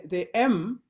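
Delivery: ordinary talk, nothing marked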